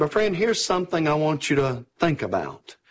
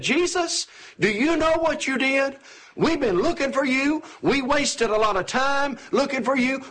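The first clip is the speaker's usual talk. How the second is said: put-on higher-pitched, exasperated scolding of a lost child; worried mother finally letting it all out, voice pitched up; upset demanding mother; 8 semitones higher than usual. Mary scolding the son she found in the temple, upset and higher. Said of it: "she kind of demands an answer".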